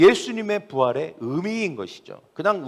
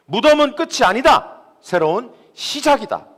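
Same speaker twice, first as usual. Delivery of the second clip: loud, high-pitched declaration as Jesus; triumphant proclamation; 8 semitones higher, 7 dB louder than usual. Acted. Preacher voices Jesus declaring the tomb is no end, triumphant proclamation.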